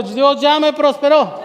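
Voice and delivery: loud, slow, emphatic proclamation; rallying shout. Real, not acted. Preacher makes the congregation repeat after him, loud and slow. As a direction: drive it home loudly, drawing out the words.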